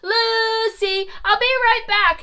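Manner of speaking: high loud hurried call. Acted. Mother Goose calls for Lucy while running to the kitchen. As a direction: call back as you run off.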